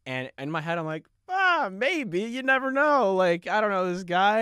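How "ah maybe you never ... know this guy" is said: high, louder mock-innocent shrug; feigned innocence. Hiding that he is [name] from his friends, innocent. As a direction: play it cool in a much higher voice. Secret [name] playing dumb inside.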